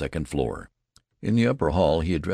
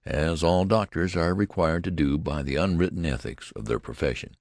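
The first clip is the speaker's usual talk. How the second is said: ordinary delivery, nothing marked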